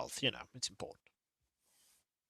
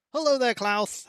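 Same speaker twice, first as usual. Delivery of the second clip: cheery greeting; 8 semitones higher than usual. Real, not acted. Greeting a chat viewer.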